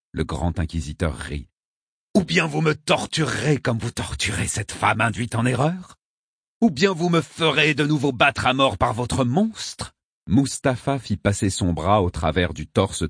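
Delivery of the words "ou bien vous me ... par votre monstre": high, scornful rhetorical questions; raised-pitch defiant taunt; defiant challenge. A narrator's reading. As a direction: challenge the captor with rising outrage. Inquisitor defiantly taunts his captor.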